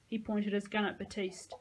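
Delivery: ordinary delivery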